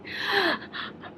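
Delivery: sharp high gasp of shock; retold gasp. Mother-in-law on the phone, a sharp shocked gasp. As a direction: imitate a mother's horrified gasp.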